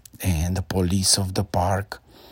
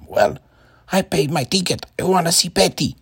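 put-on whiny boy; 9 semitones higher, 5 dB louder than usual. A narrator's reading. Boy demands to see bear.

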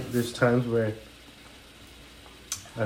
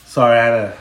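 ordinary talk, nothing marked

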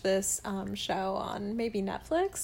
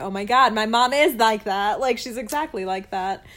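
excited recognition; 8 dB louder than usual. Retold or imitated. Retells own amazed recognition.

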